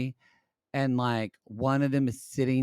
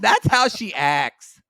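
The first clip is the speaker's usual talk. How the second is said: dramatic diva; 7 semitones higher, 9 dB louder, slower than usual. Retold or imitated. Imitating dramatic drag queen.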